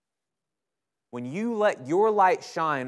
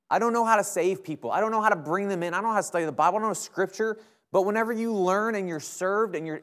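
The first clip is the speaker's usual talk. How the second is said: rising, pitched-up preaching voice, pressing each line; impassioned preaching; 7 semitones higher than usual. Acted. Preacher rallying students to serve, pitched high and fervent. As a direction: build the fervour line by line as you exhort.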